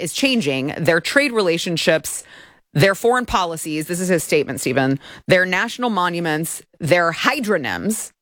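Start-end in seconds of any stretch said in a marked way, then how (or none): none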